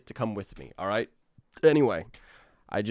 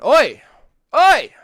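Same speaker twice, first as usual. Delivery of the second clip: loud high shout; loud cheery oi; calling out; 15 dB louder than usual. Real, not acted. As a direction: yell it out playfully.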